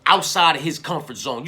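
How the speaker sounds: usual speaking voice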